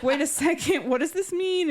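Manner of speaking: high, incredulous mock disbelief; high-pitched retold bewilderment; startled question. Retelling a character's startled question.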